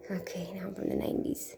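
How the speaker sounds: soft unvoiced whisper to herself. Real, not acted. Vlogger whispering to herself about her nineties look. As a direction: whisper it under your breath.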